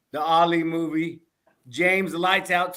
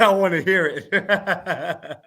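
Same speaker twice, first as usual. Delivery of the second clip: slow, laughing relay of her words; laughing quote; 3 dB louder, much slower than usual. Retold or imitated. Host passing on the wife's request while laughing. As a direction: drag the quote out while cracking up.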